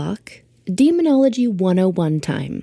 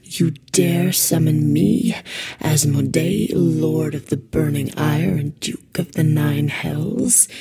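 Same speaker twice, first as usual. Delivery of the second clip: slow, deep menacing demon lord; booming demon voice; 3 semitones lower, slower than usual. Acted. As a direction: intone it like an ancient evil. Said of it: "You don't mind if I drop the voice".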